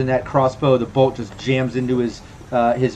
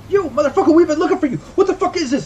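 high-pitched, frantic indignation; acted outburst, voice pitched way up; outraged accusation; faster than usual. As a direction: lash out while struggling to get free. Struggling character lashes out.

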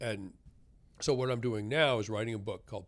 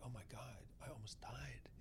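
whispered, stunned; shocked whisper; 19 dB quieter than usual. Retold or imitated. Alone in the ICU after nearly dying, stunned whisper. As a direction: breathe it out in quiet shock.

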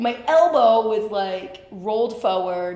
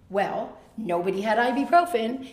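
ordinary talk, nothing marked

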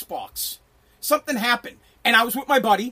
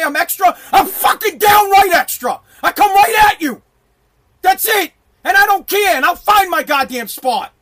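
loud, high-pitched defiant brag; cocky shouted self-declaration; loud boast; 6 semitones higher, 7 dB louder than usual. Real, not acted. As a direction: boast it at full voice. Boastful rant about himself.

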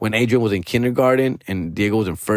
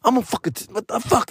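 imitated angry outburst, much higher; mad; 11 semitones higher than usual. Ex furious the nanny told him about missed pay, mock outburst. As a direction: mimic her shrill fury at the nanny. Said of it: "she would get all mad".

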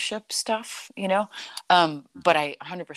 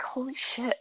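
breathless startled whisper, pitched up; whispered shock; 9 semitones higher, 4 dB quieter than usual. Retold or imitated. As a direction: gasp it out under your breath. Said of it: "I was like".

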